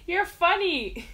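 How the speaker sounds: high, slow words through laughter; laughing. Real, not acted. Vlogger amused by a viewer's comment, laughing. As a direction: say it while cracking up.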